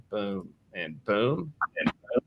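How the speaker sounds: ordinary talk, nothing marked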